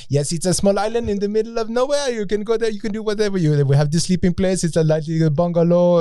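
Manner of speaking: high-pitched mock French salesman; French accent. Island host selling his bungalow to travellers, French accent. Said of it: "it was a French accent".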